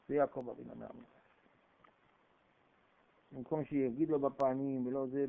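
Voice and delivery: ordinary talk, nothing marked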